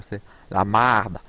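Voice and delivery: slow, drawn-out "marde" with a broad ah; Québécois "marde". Shown as a demonstration. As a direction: stretch the open a in "marde". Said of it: "un truc marrant chez les Québécois, ils disent".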